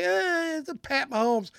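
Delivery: high, whiny mock protest; mock freak-out. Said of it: "gonna freak out and say".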